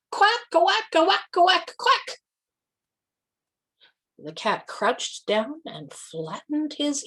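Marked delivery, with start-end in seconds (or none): 0.1-2.2 loud, high quacks; duck quacking. A narrator's reading. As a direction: quack proudly like a duck trying to crow. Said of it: "and crowed".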